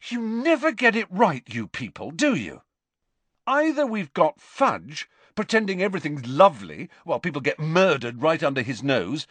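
heated, high, accusing outburst; angry, rising temper. Acted. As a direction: let the anger boil over. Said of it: "his temper rising now".

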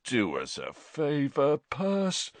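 slow, drawled through a yawn; sleepy, bored drawl. A narrator's reading. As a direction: yawn the words at your brother.

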